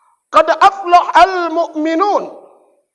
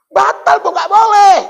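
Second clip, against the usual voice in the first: acted widow scene; 3 semitones higher than usual.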